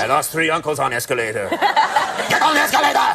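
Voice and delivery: put-on playful Russian accent, second time; Russian accent, jokey; mock Russian accent. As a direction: clip of the Russian accent gag with a laugh. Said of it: "let me imitate the Russians".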